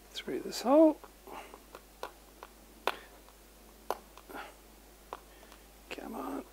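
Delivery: whispered, fiddly focus; threading a needle. Real, not acted. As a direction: coax it through in a whisper. Threading a zip tie through.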